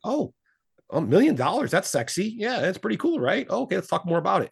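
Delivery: high, impressed wife voice; mock impressed wife. Husband imitating his wife gushing over a million dollars, high and impressed. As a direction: lift the voice up high and gush over the money. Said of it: "she's gonna be like".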